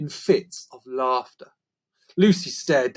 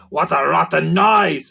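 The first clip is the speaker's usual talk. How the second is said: louder creaker voice, the corrected word; 2 semitones higher, 6 dB louder than usual. A narrator's reading. Narrator voicing Sniff, correcting the word, in a louder creaker voice. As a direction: say the line again, louder, as the creature.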